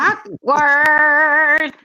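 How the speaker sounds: high, long-held "Awkward"; singsong awkward. Real, not acted. Listener reacting to an awkward clip, drawn-out singsong.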